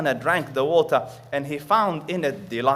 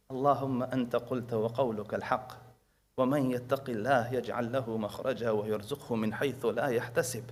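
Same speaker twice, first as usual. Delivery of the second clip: quiet prayer; 3 semitones lower, 7 dB quieter, much faster than usual. Retold or imitated. Girl prays quietly in back room.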